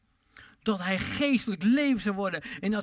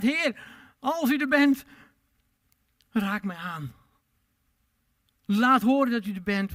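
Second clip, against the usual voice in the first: loud, raised, pleading cry to the Lord; crying out to God; 5 semitones higher, 7 dB louder than usual. Retold or imitated. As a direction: call out to heaven, desperate and loud. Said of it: "Hij riep alleen maar uit".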